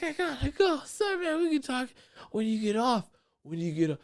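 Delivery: high, rushed apologetic voice; hurried brush-off. Retold or imitated. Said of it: "And I'm like".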